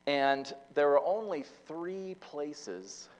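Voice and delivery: ordinary talk, nothing marked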